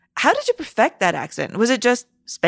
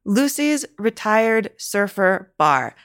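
slow, word by word to get it right; careful repeat-back; much slower than usual. Shown as a demonstration. Host slowly repeating the bar's name to memorise it. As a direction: spell out each word slowly. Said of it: "I'm gonna repeat this back to make sure".

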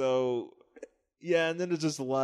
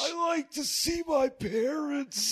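put-on much higher, slow, shouted creepy child; creepy kid voice; 13 semitones higher, much slower than usual. Voicing the voyeur kid in the comic, high and creepy. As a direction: stretch it out like an eerie little kid. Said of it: "he's like".

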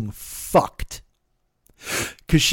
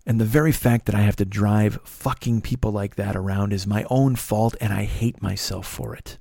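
low, subdued confession; bitter self-reproach; 5 semitones lower, 4 dB quieter than usual. Real, not acted. Driver blaming self for the job, bitter self-reproach. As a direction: sink lower as the self-blame lands. Teller blames self after quarrel.

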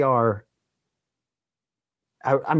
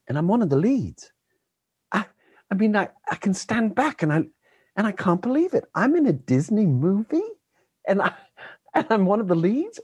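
imitating a child, pitched much higher; a wide-eyed kid who can't believe his luck; excited kid; 11 semitones higher than usual. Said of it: "he's like".

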